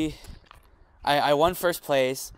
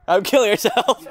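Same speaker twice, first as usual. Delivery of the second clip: laughing; 7 semitones higher, 9 dB louder, slower than usual. Real, not acted. Joking fake name, laughing.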